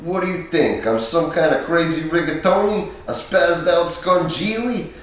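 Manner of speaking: a mock-angry Pesci impression; Pesci impression getting mad. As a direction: ham up the hothead's outburst. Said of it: "I always do you getting mad".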